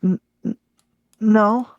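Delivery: high, lost ghost voice. Acted. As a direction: speak like a confused spirit.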